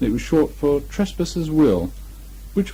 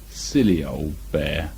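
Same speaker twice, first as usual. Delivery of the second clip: low, slow and tender; loving; 9 semitones lower, 3 dB quieter, slower than usual. A narrator's reading. Christopher Robin watching Pooh go, tender and low. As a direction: say it fondly, under your breath. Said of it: "looked after him lovingly".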